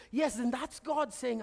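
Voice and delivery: usual speaking voice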